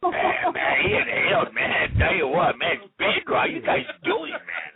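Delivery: Bill Clinton voice. Retold or imitated. Caller hamming up Bill Clinton for the hosts. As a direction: ham up the Clinton drawl, man. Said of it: "I do Bill Clinton's voice".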